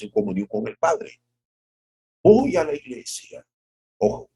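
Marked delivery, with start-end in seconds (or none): none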